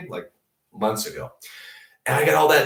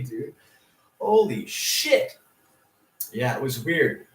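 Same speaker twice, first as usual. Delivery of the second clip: ordinary talk, nothing marked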